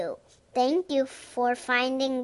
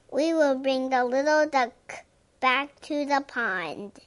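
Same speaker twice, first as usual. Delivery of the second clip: ordinary delivery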